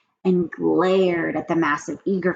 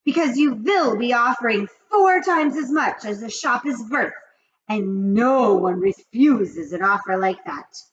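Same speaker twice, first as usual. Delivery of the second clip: high, loud witch rant; 5 semitones higher, 8 dB louder than usual. Acted. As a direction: rage like the Grand High Witch. Grand High Witch speech.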